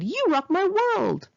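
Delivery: high, slow mock-gushing fanboy; imitating the clueless guy's high, slow praise; mocking his gush. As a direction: ham up his cheesy compliment. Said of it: "He said".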